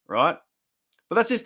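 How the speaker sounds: usual speaking voice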